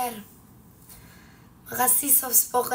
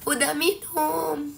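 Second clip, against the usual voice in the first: higher, slow, stretched-out repeat; forced voice; 4 semitones higher, slower than usual. Real, not acted. Repeating to viewers that they already drank, forcing the voice. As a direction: force the hoarse voice up and draw the words out.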